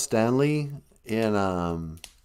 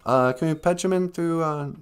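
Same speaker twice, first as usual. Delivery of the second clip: ordinary delivery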